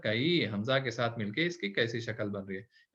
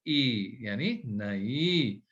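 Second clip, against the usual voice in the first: नई stretched very slowly; stretched teaching word; slow model word; 4 semitones lower, much slower than usual. Shown as a demonstration. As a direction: draw out नई for the pupil. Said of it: "और ये है".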